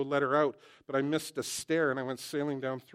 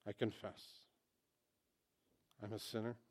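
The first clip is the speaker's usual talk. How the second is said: prayer lead; 2 semitones lower, 7 dB quieter than usual. Acted. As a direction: lead them gently in prayer. Leads sinner's prayer.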